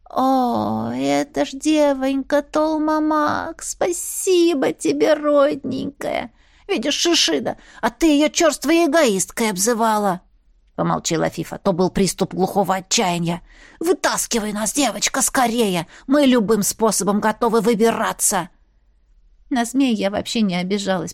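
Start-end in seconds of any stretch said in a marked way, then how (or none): 0.1-10.2 slow, tender snake voice; grateful old snake. Acted. 10.7-18.5 gruff snake voice. Acted. Other snake snaps.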